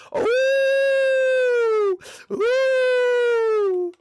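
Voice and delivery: very high, loud, held whoops; gloating whoop. Real, not acted. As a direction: whoop long and high over a burn. Whooping after roasting friend.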